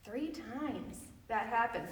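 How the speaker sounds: ordinary talk, nothing marked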